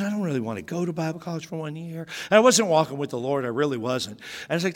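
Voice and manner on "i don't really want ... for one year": fast, soft whine; mock whine it quickly; whiny reluctance. Son whines to dad about Bible college, fast and soft.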